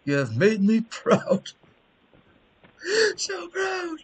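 high, hammed-up choked-up praise; mock overcome with pride. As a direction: squeak it out as if badly faking happy tears. Mock-proud praise of game companions.

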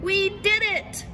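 high, slow triumphant whoop; girl whooping over the landmark. Acted. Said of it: "Emma Jean whooped".

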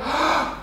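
sharp gasping inhale with a hook; quick hooked gasp in; hook breath gasp. Shown as a demonstration. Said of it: "I will show you with the hook".

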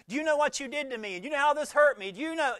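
high, loud, fast nagging-mom voice; hammed-up hurt mom scolding; mock hurt mom. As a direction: lay on the guilt like a wounded mother. Mom's guilt speech, acted.

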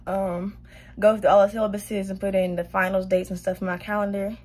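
normal talking voice

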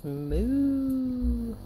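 high playful moo; cow moo. Pretend. Mother imitating a cow.

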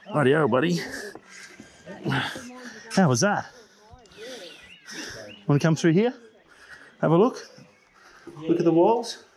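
high gentle encouraging voice. Real, not acted. Dad coaxing son in cave.

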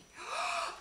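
sharp high delighted gasp; excited gasp. Real, not acted. Gasping as she spots the eyelashes, thrilled.

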